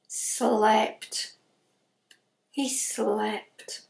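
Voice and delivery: hushed whisper. A narrator's reading. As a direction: whisper it like a secret. Santa sleeping, storyteller hushes.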